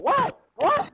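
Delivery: high-pitched incredulous what, twice; shocked reaction. Retold or imitated. Friend hearing about the scam, shocked. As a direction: imitate a stunned friend's sharp rising what.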